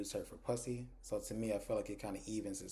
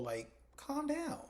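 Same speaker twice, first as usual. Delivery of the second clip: exaggerated calm down; much slower than usual. [name] telling Tony to calm.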